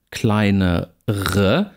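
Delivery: "kleinere" with the R fully sounded before the final schwa; consonantal R. Shown as a demonstration. Lecturer contrasts it with kleiner, consonant R. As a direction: split "kleinere" to show a clear consonant R. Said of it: "Hier R durchaus, ein Konsonant".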